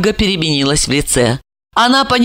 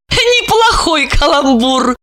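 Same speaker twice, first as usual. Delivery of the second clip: gloating; 8 semitones higher, slower than usual. A narrator's reading. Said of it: "и рассмеялась".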